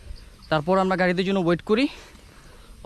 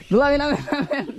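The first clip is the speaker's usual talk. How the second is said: high-pitched call down the steps; raised, high urging call; 9 semitones higher than usual. Real, not acted. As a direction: call out to your brother-in-law to come down. Calling brother-in-law down the steps.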